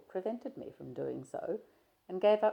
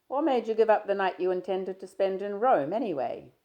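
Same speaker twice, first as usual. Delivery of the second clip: a louder, lightly voiced young man, breezy; Stephen, teasing; 5 semitones higher, 10 dB louder than usual. Stephen greeting his shy friend, easy and teasing. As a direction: play Stephen chatting easily, a touch louder.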